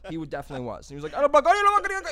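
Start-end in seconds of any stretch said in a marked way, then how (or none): 1.2-2.1 much higher and louder shrieked threat; scammer screaming. Retold or imitated. Scam caller screaming threats, mocked as very high. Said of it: "He was fucking screaming at me".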